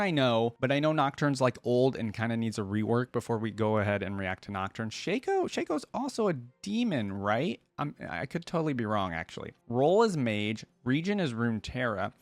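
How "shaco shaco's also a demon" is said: high, excited realization. Real, not acted. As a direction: pitch leaps up as it dawns. Sudden realization about Shaco.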